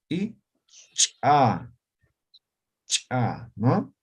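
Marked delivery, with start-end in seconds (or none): 0.9-3.5 "cha" sharp and popped; explosive cha. Shown as a demonstration. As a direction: burst out the "cha". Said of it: "que es palatal". ("cha" explosiva)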